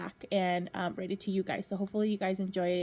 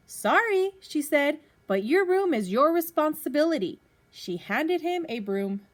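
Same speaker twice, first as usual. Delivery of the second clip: lively storybook reading to a class; reading to children; 7 semitones higher, 9 dB louder, slower than usual. Acted. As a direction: read it out like a teacher at story time. Teacher reads picture book to class.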